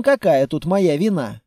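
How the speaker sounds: usual speaking voice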